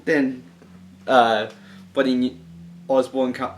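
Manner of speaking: ordinary talk, nothing marked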